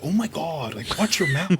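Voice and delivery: mock scandalized. Son scolding his cussing dad, mock scandalized. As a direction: scold in a high, put-on voice.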